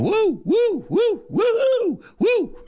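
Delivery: high whooping woo calls; imitating bar woos. Host recalling college bar crowds, high woo whoops. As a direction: whoop like drunk college kids. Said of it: "everybody was going".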